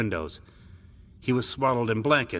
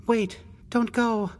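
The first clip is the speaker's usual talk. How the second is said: high, slow adolescent plea; pleading boy; 3 dB louder, slower than usual. Acted. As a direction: beg your brother to stay, pitched way up. Feverish boy pleads with brother.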